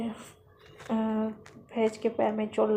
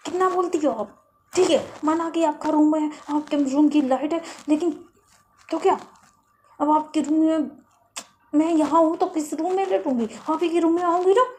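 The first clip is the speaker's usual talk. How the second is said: loud indignant retort; 5 semitones higher, 6 dB louder than usual. Retold or imitated. Faiz argues back loudly.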